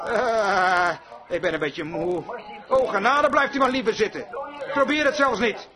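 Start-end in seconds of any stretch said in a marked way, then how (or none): 0.1-2.2 sag into a lazy, tired excuse; mock weary believer. Lazy believer telling the Lord he is too tired to pray, mock weary mumble. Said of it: "zegt dan niet".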